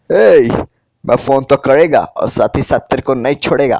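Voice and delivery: loud menacing gangster growl; tough goon. Acted. Goon on the phone threatening Siddhu, loud and menacing. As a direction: threaten him like a street thug. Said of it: "वो बंदा कहता है".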